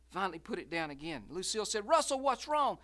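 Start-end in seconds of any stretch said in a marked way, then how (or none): 1.9-2.8 much higher and louder alarm; urgent question. Acted. Lucille pressing Russell why he stopped, high and urgent. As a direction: demand an answer fast.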